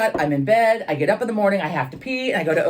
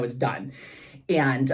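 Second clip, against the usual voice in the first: ordinary talk, nothing marked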